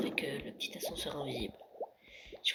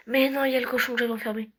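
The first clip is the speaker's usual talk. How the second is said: higher, louder and fast, caught off guard; surprised; 7 semitones higher, 10 dB louder, faster than usual. Real, not acted. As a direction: blurt it out as the discovery hits. Finds the pig he locked up. (surpris, plus aigu, plus fort et rapide)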